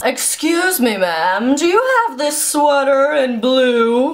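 mock sultry shopper voice, drawn out; sexy voice. Shown as a demonstration. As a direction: ham up a seductive purr. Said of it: "talking in a sexy voice".

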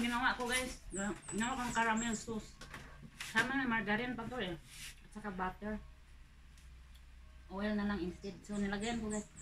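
talking while eating; chewing popcorn. Real, not acted. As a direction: mouth partly full. Seen 3: Holds popcorn at her mouth throughout, eating between phrases.

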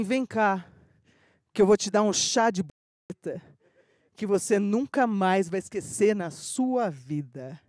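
low, slow seductive murmur; sensual voice. Retold or imitated. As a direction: purr it close to her ear. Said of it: "com uma voz sensual".

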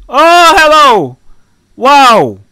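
loud, high, drawn-out startled hello; startled greeting. Real, not acted. Player meeting a sudden stranger, loud startled hello. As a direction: jump at someone suddenly there.

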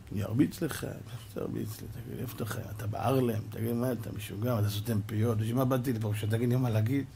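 whispered, soft scolding; hushed retort. Retold or imitated. Rabbi quietly rebukes the heckler, hushed voice. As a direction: lean in and hiss it quietly.

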